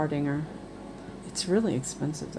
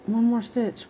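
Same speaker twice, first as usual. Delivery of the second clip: high voice, imitating a pleading child; 6 semitones higher than usual. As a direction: mimic a higher, wheedling voice. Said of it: "It's like".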